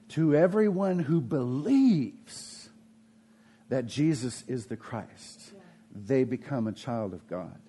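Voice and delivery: hushed scripture read. Acted. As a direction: read the verse gently, slowly. Reads scripture verse.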